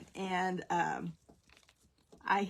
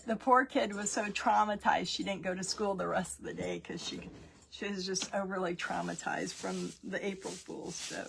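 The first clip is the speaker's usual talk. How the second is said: usual speaking voice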